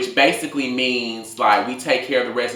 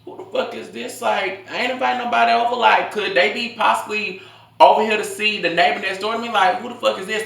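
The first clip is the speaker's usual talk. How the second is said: higher, fast, suspicious inner voice; 11 semitones higher than usual. Retold or imitated. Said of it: "So I'm thinking to myself".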